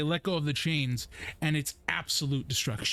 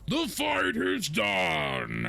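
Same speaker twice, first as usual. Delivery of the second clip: higher, very slow proclamation; chief's proclamation; 6 semitones higher, much slower than usual. Acted. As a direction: proclaim it slowly to the arena. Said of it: "he goes".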